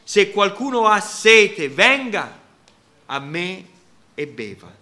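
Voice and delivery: loud, slow proclamation of "Se qualcuno ha sete" fading at the end; exclaimed. Shown as a demonstration. Preacher voicing Jesus crying out to the thirsty, loud and slow. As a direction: cry it out like Jesus calling the crowd. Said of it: "Gesù esclamò dicendo".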